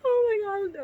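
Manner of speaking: high cry of laughing embarrassment; laughing in disbelief. Real, not acted. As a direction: crack up at how bad it is. Cringing at her own fanfic.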